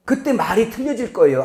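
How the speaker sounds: ordinary delivery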